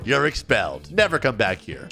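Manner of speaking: low, firm put-on sternness; stern dismissal. Principal expelling a bully, stern. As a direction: pronounce the sentence as a principal.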